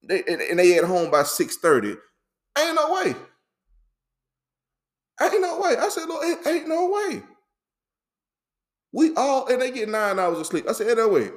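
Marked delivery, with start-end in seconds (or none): 2.6-7.2 very high-pitched, squealed refusal to believe; hammed-up high disbelief; incredulous disbelief. Telling the Lord those busy days are impossible, incredulous. 8.9-11.3 high, hurried disbelieving protest; incredulous outburst. Retold or imitated. Scoffing at folk claiming nine hours of sleep, incredulous.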